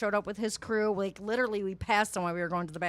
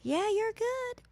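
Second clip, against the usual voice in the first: mimicking the clerk; 14 semitones higher, much slower than usual. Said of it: "she goes".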